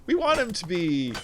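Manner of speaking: a hint of an eager executive; TV producer pitch. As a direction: pitch a character idea to the room. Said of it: "when the producers are like".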